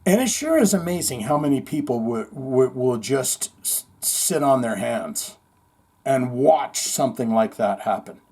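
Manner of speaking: usual speaking voice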